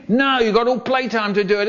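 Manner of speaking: high, rapid refusal; strict teacher. Acted. Teacher refusing the whiny kid, strict. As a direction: rattle it off as a scolding teacher. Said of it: "a teacher who was so strict".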